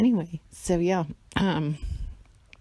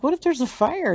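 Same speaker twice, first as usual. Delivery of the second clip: worried question; 10 semitones higher, 4 dB louder than usual. Retold or imitated. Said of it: "And I'm like".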